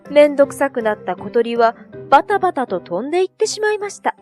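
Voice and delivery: ordinary delivery, nothing marked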